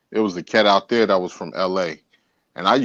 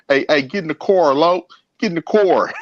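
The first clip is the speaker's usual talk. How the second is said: higher, mock LA homie calling out; friend's LA voice; 6 semitones higher than usual. Friend calling him into the car, playful mock LA voice. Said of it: "was like".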